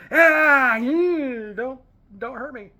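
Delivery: high, loud cartoon robot villain cry, trailing into a whine; squealing cartoon villain begging not to be hurt; Starscream voice. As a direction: whimper like a cowardly cartoon villain. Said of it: "you can hear that voice actor doing that".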